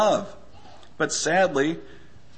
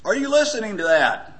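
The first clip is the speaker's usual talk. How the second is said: raised, higher call to someone in another room; mock yell, higher pitched; retold yell; 6 semitones higher than usual. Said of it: "And he yells".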